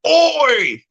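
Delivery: very high, loud New Zealand Oi; mock Kiwi Oi. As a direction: shout Oi like a mocked Kiwi. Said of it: "they'll go".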